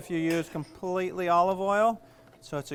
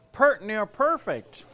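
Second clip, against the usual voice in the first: slow, drawn-out Southern accent on pert near; southern talk; 4 semitones higher, 5 dB louder, slower than usual. Shown as a demonstration. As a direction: stretch it out in a folksy Southern accent. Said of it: "There's a little southern talk for you".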